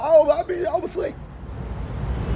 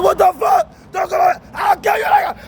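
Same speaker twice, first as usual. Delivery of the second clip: high shouted threat, voice cracking up; mock panicked yell; 6 semitones higher than usual. Shouting at the boy coming to kiss him. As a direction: yell it in a frantic schoolboy panic. Retelling screaming at boy in school.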